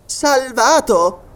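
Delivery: slow, high questioning exclamation; Robert exclaiming. Acted. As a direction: draw it out in astonished hope. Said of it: "esclamò Robert".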